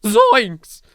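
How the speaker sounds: high, loud cartoon yelp; Shaggy impression. Comparing herself to a scared cartoon character, in a high loud Shaggy yelp. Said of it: "feminine Shaggy".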